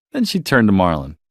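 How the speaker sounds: usual speaking voice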